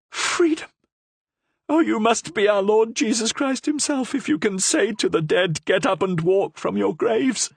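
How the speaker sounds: high, rapturous prisoner voice; ecstatic. A narrator's reading. Prisoner hailing the Cardinal as Christ, high and rapturous. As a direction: praise him in rapture.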